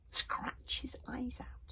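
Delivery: slow whispered hiss; hissing dragon. Acted. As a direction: hiss it with malice. Frightful Claw hissing.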